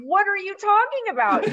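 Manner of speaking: high, loud panicked cry; alarmed outburst at her sister's warning; freaking out. Retold or imitated. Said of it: "I start freaking out".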